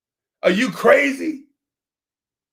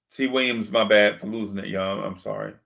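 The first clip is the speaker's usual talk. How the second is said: sincere apology; 10 semitones lower, 6 dB quieter than usual. Real, not acted. Apologising to chat for losing it.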